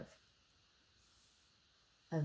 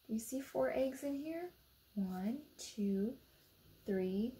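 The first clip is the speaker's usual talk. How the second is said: ordinary delivery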